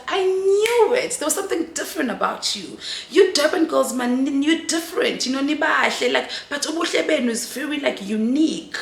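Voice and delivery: high, loud retelling of an excited woman; excited exclamation. Retold or imitated. Cover girl to Durban girl she finds different, excited.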